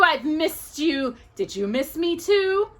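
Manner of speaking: high, slow, honeyed witch; sugary witch voice. A narrator's reading. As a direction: coo it like a witch. Witch voices in Hocus Pocus story.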